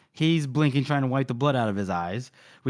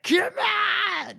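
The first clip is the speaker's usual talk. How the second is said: high-pitched battle scream; hammed-up screamed challenge. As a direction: scream it like a fighter charging a beast. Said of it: "screaming at it".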